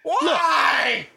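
high, hammed-up shouting demand; mock shout. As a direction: yell it like a fan demanding a match, drawn out.

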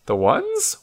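high, rising puzzlement; puzzled question. Acted. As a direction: echo it back, baffled. Puzzled listener questions the old man.

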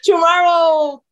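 high, loud begging wail; mock pleading cry. Athlete begging hosts for English help, mock wailing plea. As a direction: beg loudly in broken English.

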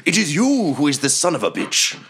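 high, theatrical villain voice; indignant wizard retort. Acted. Game master's wizard answers the charging player, high indignant retort. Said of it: "He says".

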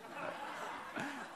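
silent airy laugh; a soundless disbelieving laugh; huffed laugh at the excuse; breathless laugh. Real, not acted.

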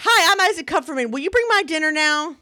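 put-on very high, proud little-kid voice; little Isaac introducing himself. Boy introducing himself at the drive-thru, high and proud. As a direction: announce yourself like a tiny VIP. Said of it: "He says it everywhere we go".

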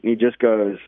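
normal talking voice

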